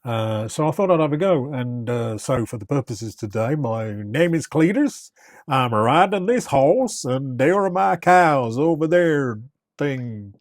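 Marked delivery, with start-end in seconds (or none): 3.6-10.3 hammed-up American cowboy accent, higher and slow; a deliberately terrible American cowboy drawl. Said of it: "This is a terrible accent".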